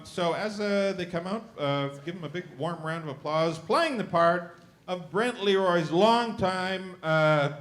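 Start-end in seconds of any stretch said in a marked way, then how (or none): 3.6-7.5 booming game-show announcer; announcer intro. Acted. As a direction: sell each name to the crowd. Host introduces cast members.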